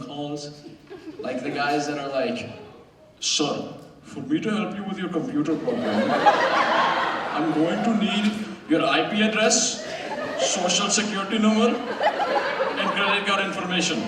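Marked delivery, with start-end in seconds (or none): 3.2-14.0 put-on scam-caller Indian accent; mock Indian tech-support voice. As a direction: mimic an Indian call-centre scammer. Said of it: "our accents, especially".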